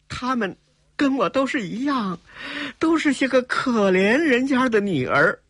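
high, plaintive woman's voice; tearful plea. A narrator's reading. Young woman explaining the captives are poor daughters, tearful. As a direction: plead pitifully, close to tears. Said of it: "眼泪可就禁不住连连的淌了下来".

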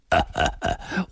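soft voiceless chuckle; old man's chuckle. A narrator's reading. Amused grandfather before explaining, chuckling. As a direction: chuckle in short puffs. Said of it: "孙老先生笑了".

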